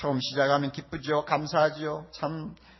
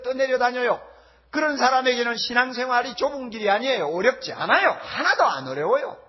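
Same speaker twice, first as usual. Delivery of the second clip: louder, emphatic pulpit delivery; forceful preaching; 6 semitones higher, 5 dB louder than usual. Real, not acted. Preacher warning the congregation about the narrow path, forceful preaching. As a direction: preach it with raised voice.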